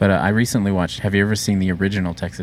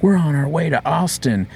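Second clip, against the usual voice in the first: higher-pitched rowdy road-trip dudes; mock excited Texas-bound partiers; hyped movie dudes; 8 semitones higher than usual. Two dudes driving to Austin, rowdy and high-pitched.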